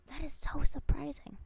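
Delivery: whispered mock surprise; whispering fake surprise at the chat; sarcastic whisper. Real, not acted. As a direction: hush it out, dripping sarcasm.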